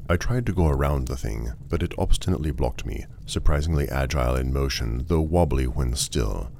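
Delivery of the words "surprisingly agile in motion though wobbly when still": plain reading voice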